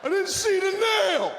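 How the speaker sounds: hammed-up screaming dad; father yelling. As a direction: blow up at the kid over a tire. Father yelling about flat tire.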